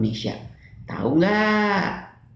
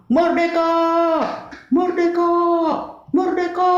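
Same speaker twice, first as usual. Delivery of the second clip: high, loud freedom cry; shouted cheer; 5 dB louder than usual. Acted. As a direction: shout it like a crowd celebrating. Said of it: "Semua orang berteriak merdeka".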